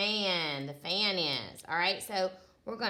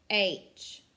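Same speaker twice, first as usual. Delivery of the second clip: ordinary talk, nothing marked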